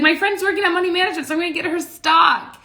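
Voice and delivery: a bubbly, chattering friend; excited friend. Retold or imitated. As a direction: rush the friend's explanation in her high voice. Friend's quoted reasoning.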